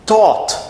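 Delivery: "taught" with a crisp initial T, high and loud; T sound. Shown as a demonstration. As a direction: start "taught" on a hard T, not TH. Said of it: "Com som de T".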